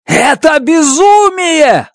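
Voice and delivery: high, slow outraged cry; yelling. Acted. Grace yells at Stratt, outraged. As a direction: shout it out in disbelief. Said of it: "заорал я".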